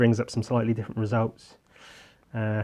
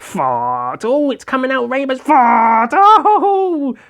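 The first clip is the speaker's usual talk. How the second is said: much higher, louder excited yelling; excited shouting; 12 semitones higher, 8 dB louder, slower than usual. Pretend. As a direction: yell in giddy delight. Voicing comic character, rainbow farts.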